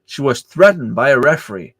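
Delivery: ordinary talk, nothing marked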